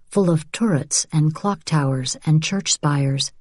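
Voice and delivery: plain reading voice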